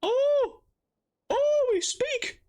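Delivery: high-pitched, delighted gasp of surprise; genuinely surprised, voice shooting up. Real, not acted. Player surprised character talks back.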